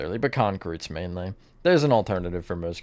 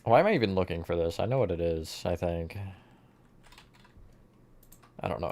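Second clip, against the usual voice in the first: ordinary talk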